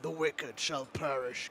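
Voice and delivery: low, slow, ominous intoning; dark voice. Retold or imitated. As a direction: echo the villain line slow and dark. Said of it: "can you talk in a really dark voice".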